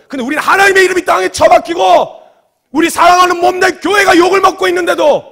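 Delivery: shouted, high-pitched indignation, re-enacted; forceful rebuke. Preacher scolding pastor and members over the cult's zeal, forceful rebuke. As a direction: hammer each phrase home at full voice. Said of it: "제가 말은 이렇게 세게 하는데".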